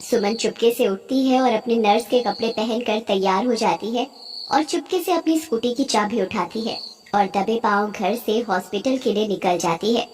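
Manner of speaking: plain reading voice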